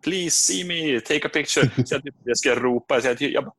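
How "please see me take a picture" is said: high-pitched mock fan plea; hammed-up starstruck begging; pleading fan. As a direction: beg in a high little voice. Imagines himself pleading as a fan.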